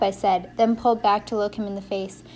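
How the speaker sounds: plain reading voice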